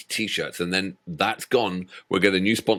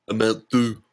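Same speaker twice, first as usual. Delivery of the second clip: put-on "Amount due" in a broad New York accent. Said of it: "say it in a New York accent".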